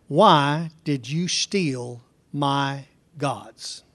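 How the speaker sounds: very slow, drawn-out question; slow accusation. Acted. Preacher reading Laban's accusation, very slow. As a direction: drag out each word of the accusation.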